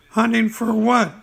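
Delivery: high, small piglet voice; Piglet's little voice. Acted. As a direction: squeak it like a tiny piglet. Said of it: "said Piglet".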